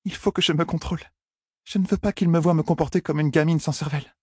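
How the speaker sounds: put-on high-pitched young woman's inner voice; high voice of a self-conscious heroine; young woman's self-control. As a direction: urge yourself to keep control, pitched high. Heroine's anxious inner thoughts.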